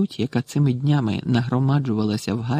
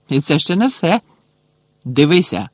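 louder, showy announcement; gloating; 3 semitones higher, 4 dB louder than usual. A narrator's reading. Malfoy shows Potter what the badge does, gloating. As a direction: show off a nasty trick.

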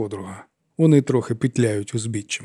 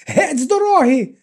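much higher shriek; fighting her way out, shrieking; 4 dB louder than usual. A narrator's reading. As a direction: scream in a nightmare panic. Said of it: "верещала".

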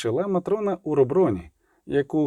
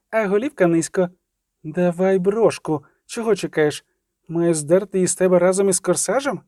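high, scornful menace; sneering, higher-pitched demand; hissed threat; 5 semitones higher than usual. A narrator's reading. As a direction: threaten her with contempt. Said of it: "прошепіла".

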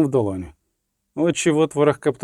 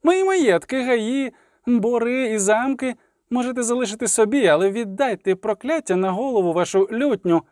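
high indignant listing at normal volume; poet's protesting shout; 7 semitones higher than usual. A narrator's reading. Poet demanding his belongings back, high protest. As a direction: protest high, item after item. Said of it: "крикнув Любисток".

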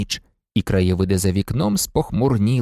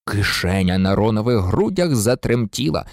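usual speaking voice

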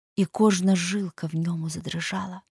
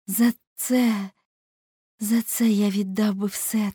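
put-on higher, impassioned young man's lament; anguished Dorian; 5 semitones higher, 4 dB louder than usual. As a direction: build from regret into a fervent plea. (палкий, розпачливий монолог юнака)

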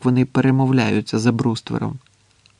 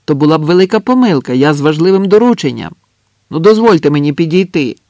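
higher, louder urgent appeal; shouting; 3 semitones higher, 6 dB louder, faster than usual. A narrator's reading. Andres with an important errand, urgently shouting. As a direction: plead loudly with the trench. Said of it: "крикнув Андрес".

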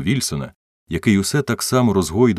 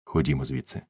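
hushed, softer and lower aside; whispered; 4 semitones lower, 6 dB quieter than usual. A narrator's reading. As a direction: murmur it low for one listener only. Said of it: "він шепнув". (пошепки)

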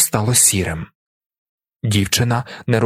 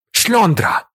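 sudden high-pitched outburst; shouted insult; 10 semitones higher than usual. A narrator's reading. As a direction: hurl the insult across the hall. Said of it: "лунає раптом на весь зал".

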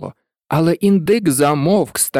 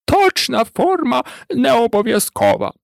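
higher-pitched character voice; explaining turkey; 7 semitones higher than usual. A narrator's reading. Turkey explaining the race track, in a high character voice. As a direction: lay down the rule as the turkey.

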